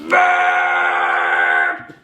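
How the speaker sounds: low loud mock burp; big burp. Baby burps.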